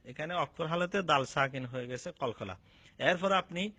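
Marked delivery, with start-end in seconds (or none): none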